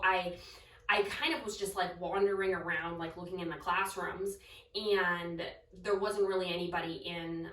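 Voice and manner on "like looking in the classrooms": ordinary talk, nothing marked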